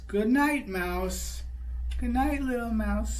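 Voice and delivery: excited high greeting. Acted. Parent delighting the child by greeting the little mouse, high and excited. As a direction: squeal it out like you just spotted him.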